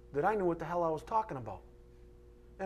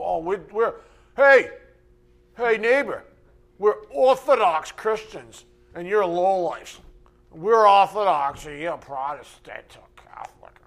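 smug snob; 4 semitones higher, 11 dB louder than usual. Retold or imitated. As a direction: ham up a snooty churchgoer. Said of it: "So don't go".

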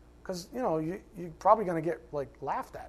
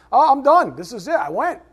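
higher, louder, breezy mock churchgoer; smug churchgoer; 10 semitones higher, 8 dB louder than usual. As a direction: parody the one-and-done believer. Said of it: "You don't come in here".

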